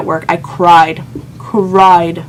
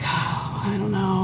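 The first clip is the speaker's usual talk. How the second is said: breathy, hushed and defeated, barely voiced; despondent near-whisper; 12 dB quieter than usual. Alone at her vanity, crushed after the breakup, hopeless and barely audible. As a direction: mumble it as if you have given up. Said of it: "I'm like".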